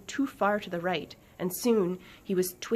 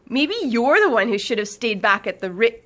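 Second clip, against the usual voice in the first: loud, higher, cut off mid-word; loud retort; 4 semitones higher, 10 dB louder than usual. Acted. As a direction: fire it back at full volume until interrupted. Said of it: "her voice still as loud as ever".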